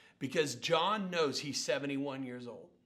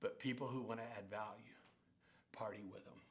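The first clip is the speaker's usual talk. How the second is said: softer, hushed confiding retelling; mock whisper; 3 semitones lower, 7 dB quieter than usual. John confiding in the speaker in a light mock whisper. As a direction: lean in and share it quietly. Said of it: "he whispered to me".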